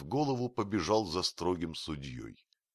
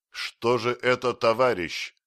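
loud, raised, hard reproach; harsh rebuke; 6 semitones higher, 7 dB louder than usual. A narrator's reading. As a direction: confront the culprit sternly. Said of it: "жёстко сказал Бендер".

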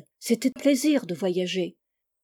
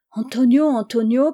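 louder, higher call of the name; crying out; 3 semitones higher, 6 dB louder than usual. Acted. Frightened girl in the attic calling to her master, crying out. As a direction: call for him in the dark, alarmed. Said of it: "criai-je".

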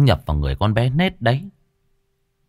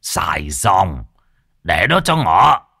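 stern shout; 4 semitones lower, slower than usual. Acted. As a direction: cut in and take charge. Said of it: "có tiếng quát".

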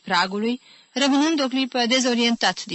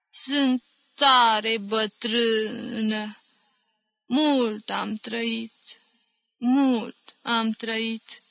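very slow, drawn-out words with long pauses; dreamlike old woman; 3 semitones higher, much slower than usual. A narrator's reading. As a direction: drift through it as if in a dream. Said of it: "zise ca în vis Iaca".

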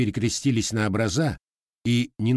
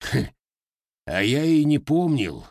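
contemptuous smirk; 5 semitones higher than usual. A narrator's reading. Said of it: "презрительно усмехнулся".

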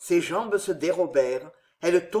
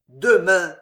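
bitter rasp; 8 dB louder than usual. A narrator's reading. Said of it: "râla le vieillard avec une immense amertume".

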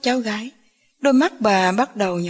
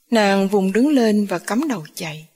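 ordinary delivery

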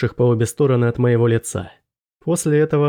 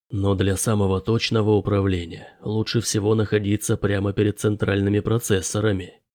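lower-pitched professor, understated; 4 semitones lower, 4 dB quieter than usual. A narrator's reading. Professor explains remote work to narrator, in a low professorial voice.

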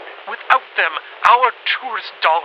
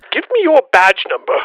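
higher, louder police demand; angry officer demand; 5 semitones higher, 7 dB louder than usual. Acted. Officer challenging suspicious callers on the phone, angry demand.

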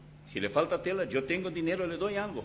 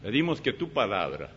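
pastoral prayer; 8 semitones lower than usual. Acted. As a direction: pray over the young people gently. Closing prayer.

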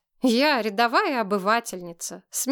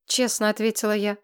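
ordinary delivery, nothing marked

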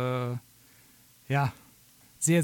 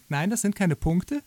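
ordinary delivery

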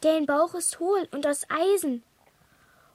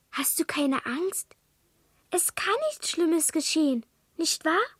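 ordinary talk

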